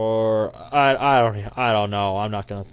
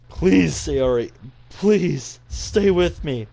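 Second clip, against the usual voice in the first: slow, higher, louder begging; desperate pleading; 6 semitones higher, 5 dB louder, much slower than usual. Acted. Narrator begs collapsing friend to stay, desperate. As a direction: hold her here with your voice.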